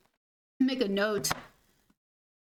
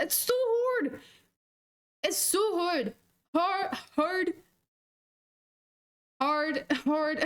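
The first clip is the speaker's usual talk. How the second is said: higher-pitched mock Irish accent; 4 semitones higher, much slower than usual. Streamer trying out the word hard, Irish accent. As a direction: repeat it as you hunt for the Irish accent. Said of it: "she said in Irish accent".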